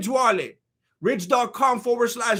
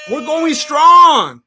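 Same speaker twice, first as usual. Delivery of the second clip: louder, very slow, higher rally call; hype shout; 4 semitones higher, 10 dB louder, much slower than usual. Real, not acted. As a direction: stretch it out like a cheer. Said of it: "cheering a member shout-out".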